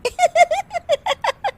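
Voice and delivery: high, hearty burst of laughing; two friends cracking up together; loud laughter. Acted. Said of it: "जोर-जोर से हंसने की आवाज". (दोनों सहेलियों की ज़ोरदार हँसी)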